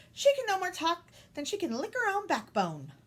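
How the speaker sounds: put-on scornful old aunt. A narrator's reading. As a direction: jeer at the idea, loud and high. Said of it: "cackled the Rani's aunt".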